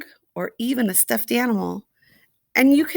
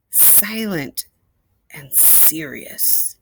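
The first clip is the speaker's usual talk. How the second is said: hushed; 5 semitones lower, 8 dB quieter, much slower than usual. A narrator's reading. Describing serious silent elephants.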